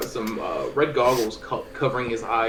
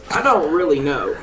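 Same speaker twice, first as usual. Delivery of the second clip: ordinary talk, nothing marked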